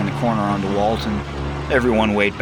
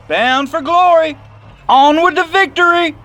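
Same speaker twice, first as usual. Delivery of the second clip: hollered at top of lungs; 11 dB louder than usual. Acted. Seth sees off the bus, hollering to the gang. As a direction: bellow it like a rally cry. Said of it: "hollered at the top of his lungs".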